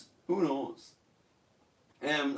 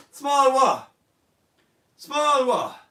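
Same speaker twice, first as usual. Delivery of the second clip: puzzled; 6 semitones higher, 5 dB louder, slower than usual. Real, not acted. Puzzled at odd meaning.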